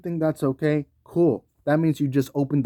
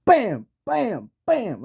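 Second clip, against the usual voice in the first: higher, hyped street-tough mock with sound effects; mock threat; 8 semitones higher, slower than usual. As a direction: play the tough guy at the door. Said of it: "me going to your house and being like".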